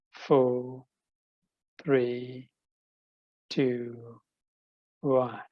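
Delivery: steady, spaced breath count; slow countdown. Acted. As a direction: count each breath calmly. (размеренный счёт дыханий)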